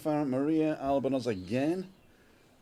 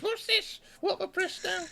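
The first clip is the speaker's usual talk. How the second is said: high mock woman's voice; squeaky imitation of his wife; imitating Christina. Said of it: "she says".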